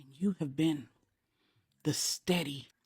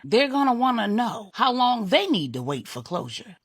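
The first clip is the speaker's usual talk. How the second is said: louder and higher, pressing hard; urgent pleading; 5 semitones higher, 6 dB louder than usual. Acted. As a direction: push him to make her see the family is waiting. Husband pressing about family waiting.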